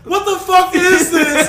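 high, loud demanding shout; alarmed shout. Retold or imitated. Girlfriend holding up the teeth from the fridge, loud alarmed shout. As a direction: demand an answer, shocked. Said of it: "and she's like".

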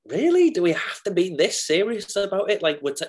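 incredulous, high-pitched mock protest at drama school; exasperated scoff. To drama school classmates, a mocking exasperated whine. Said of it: "I was kind of always like".

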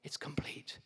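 whispered, tender declaration; near-silent, solemn finality; hushed completion. Acted. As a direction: breathe it out softly as dying words. Voicing Christ's last words on cross.

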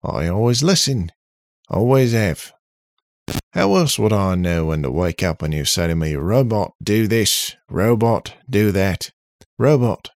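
put-on loud, brash Australian accent. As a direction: rant it like a loud Aussie. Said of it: "again in the Australian accent".

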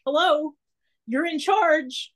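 pointed disbelief. Retold or imitated. To the choir director who just stands there, slow pointed disbelief. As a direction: drag out each word as if to say do your job.

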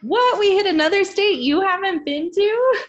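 shocked high outcry; loud disbelieving what; surprised. Real, not acted. Shocked friend hasn't visited.

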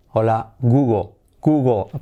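"Google" said to rhyme with Spanish "Hugo". Shown as a demonstration. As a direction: end "Google" on an O, the L barely there. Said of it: "Google es como Hugo, pero cambiando".

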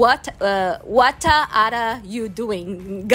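put-on a light Italian accent, with "what" said as wat and a vowel tacked on, drawn out slowly. Teacher imitating Italians speaking English, in a light Italian accent. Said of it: "os italianos, eles falam".

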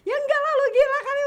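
high, loud mock scolding; exasperated refusal. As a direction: act out an incredulous manager shouting no. Acts out manager refusing outright.

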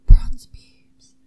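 breathy whispered echo of the line; mock-sultry whisper. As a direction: whisper it back like a backing vocal.

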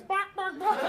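loud, very high chicken squawks; chicken clucking impression. Guest acting out chickens for baffled listeners, high loud clucking. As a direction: squawk like a chicken to get the word across. Imitating chickens to explain the word.

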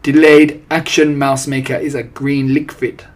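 put-on loud, accented head-witch explanation. A narrator's reading. Head witch explaining the formula to her audience, imperious. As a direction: announce it as an imperious witch teacher. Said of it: "explained the Grand High Witch".

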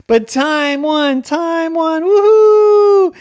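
high, loud, drawn-out whoops; cheering. Acted. Everyone celebrates the girl's win, cheering. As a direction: celebrate at full voice. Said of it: "And everyone cheered".

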